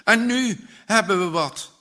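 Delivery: put-on boy shouting the big news, high and loud; loud, high-pitched, urgent announcement; excited shout. A narrator's reading. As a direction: burst in crying out the news. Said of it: "schreeuwt met overslaande stem".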